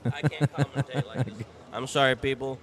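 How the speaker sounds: loud, laughing outburst. Real, not acted. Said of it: "I cannot be this quiet".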